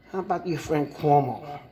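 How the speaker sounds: usual speaking voice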